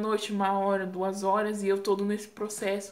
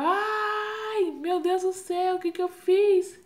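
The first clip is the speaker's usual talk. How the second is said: very high drawn-out wail, growing louder; re-enacted labour scream; 10 semitones higher, 4 dB louder, slower than usual. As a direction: imitate wailing through a contraction. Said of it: "quando vinha as contrações eu gritava".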